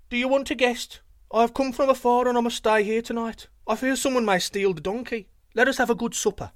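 high, loud friendly call; cheery traveller. A narrator's reading. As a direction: thief in disguise hailing the guards. Thief calls out to sentinels.

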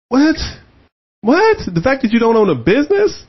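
can't believe what he just read; incredulous. Real, not acted. Co-host stunned by the no-business claim, incredulous.

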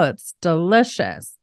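usual speaking voice

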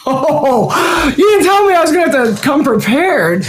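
high, startled outcry; surprised. Real, not acted. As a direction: caught off guard, voice jumps up. Caught off guard by question.

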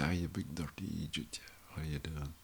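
murmured under the breath, teasing a pal; jokey mate's greeting. Retold or imitated. To a mate in the pub, a teasing half-whisper. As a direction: whisper the slag like a friendly jab.

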